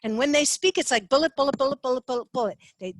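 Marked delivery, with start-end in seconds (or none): 1.1-2.6 each "bullet" fired short and punched, like rapid fire; staccato bullets. Shown as a demonstration. Teaching how the fast buyer talks, in clipped staccato bursts. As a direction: rap out "bullet" like a machine gun. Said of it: "It's kind of staccato".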